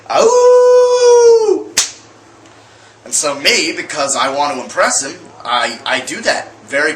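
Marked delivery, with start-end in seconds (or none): 0.1-1.7 high, loud wolf howl; howl at the moon. Retold or imitated. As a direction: throw your head back and howl like a wolf. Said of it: "he goes".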